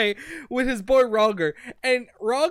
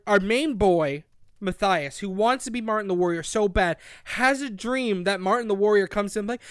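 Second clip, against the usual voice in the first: usual speaking voice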